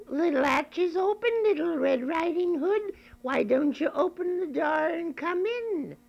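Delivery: put-on high, quavering old lady voice; grandmother. Grandmother inviting the visitor in, high old lady voice. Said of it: "and said".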